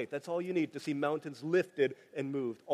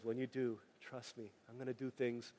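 soft voice of God; 7 semitones lower, 12 dB quieter than usual. Retold or imitated. God's promise voiced softly.

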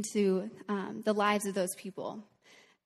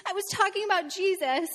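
put-on high, upset child voice between sobs; imitating a sobbing boy; 9 semitones higher than usual. Teacher retells a bawling boy, high and tearful. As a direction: mimic a little boy bawling about Jesus. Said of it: "he's, like, bawling".

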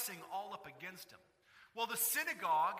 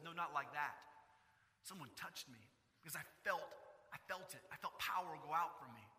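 softer, lower, earnest; quiet insistence; 5 semitones lower, 6 dB quieter than usual. Retold or imitated. Jesus quietly insisting someone touched him. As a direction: say it low and certain.

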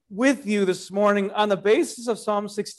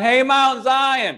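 calling up to a mountain; preacher voicing David calling out to Mount Zion, slow and hailing; 7 semitones higher, slower than usual. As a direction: hail the mountain, drawn out. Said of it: "he says to Mount Zion".

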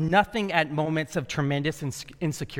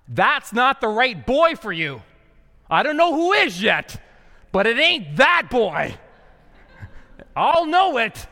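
insistent mother; 6 semitones higher, 7 dB louder than usual. Acted. Mom to her daughter about the boy, loud insistence. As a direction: lay it down high and loud, word by word.

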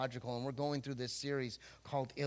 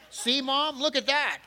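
put-on higher, louder bratty teen pointing at mom; mocking kid; 9 semitones higher, 7 dB louder than usual. Nineteen-year-old in premarital counseling to his mother, higher bratty gloat.

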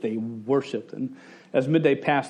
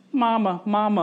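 put-on higher-pitched child calling for mom; repeated, raised kiddie call; imitated nagging kids; 6 semitones higher than usual. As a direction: imitate kids pestering their mother from the back seat. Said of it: "my kids are like".

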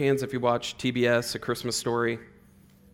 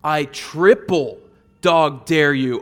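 slow, louder taunt; mock schoolyard challenge, louder; raised kid dare; 3 semitones higher, 8 dB louder, slower than usual. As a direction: lean on it like a playground dare. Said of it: "And then he goes".

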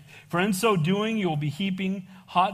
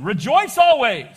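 slow, loud proclamation; preacher booming the verse slowly; proclaimed loud; 9 dB louder, slower than usual. Real, not acted. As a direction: boom it out slowly. Reading the verse with emphasis.

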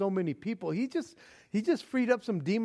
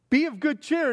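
upbeat cheer; 7 semitones higher, 4 dB louder than usual. Shown as a demonstration. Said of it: "As opposed to being gloomy".

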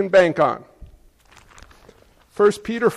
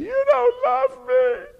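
put-on much higher, slow, drawn-out child's whine; whiny child voice; slower than usual. Father imitating his crying toddler at the doctor, high whine. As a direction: whine it like a small kid dragged to the doctor. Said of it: "my kids would scream".

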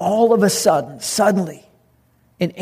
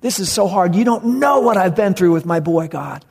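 acted desperate plea, pitched up in surges; crying out; 3 semitones higher than usual. Father begging God to help his boy, acted desperate cry. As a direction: plead in anguish, pushing the stressed words higher. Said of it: "he's, he's crying".

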